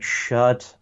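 lightly voiced fed-up Brit; annoyed UK listeners. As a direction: imitate a listener slowly telling off Americans. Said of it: "U K listeners who are just like".

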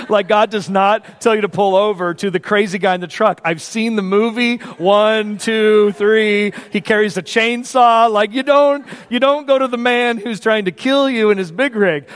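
loud, high, exasperated warning; worked-up husband's objection; incredulous protest. Acted. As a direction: argue in comic alarm, punching the words. Incredulous protest to his wife.

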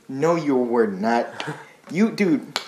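ordinary delivery

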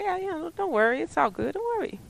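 high, soft, easygoing brush-off; carefree attitude. Retold or imitated. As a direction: say it high and light, without a care. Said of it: "their whole attitude is like".

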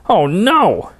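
high, loud alarmed cry; shouted oh no. Acted. Harold cries out to God in shock. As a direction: yelp it out in shock. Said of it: "and he shouts".

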